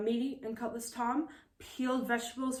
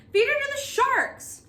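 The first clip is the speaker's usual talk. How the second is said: a much higher, louder roar; 7 dB louder than usual. Acted. Pirate demanding Molly be fed to sharks, roaring. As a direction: bellow it across the deck. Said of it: "roared Willie Woodenhand".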